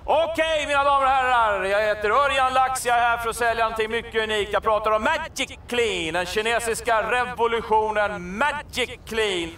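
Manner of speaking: bright huckster announcing the product; sales pitch. Acted. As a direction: sell it like a street hawker.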